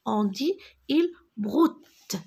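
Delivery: usual speaking voice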